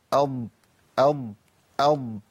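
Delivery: "أض" with the ض held and humming on; isolated Dad. Shown as a demonstration. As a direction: let the sound flow through the end of "أض". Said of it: "طب نسمع ضاد لوحدها". ("أض" بضاد ساكنة رخوة)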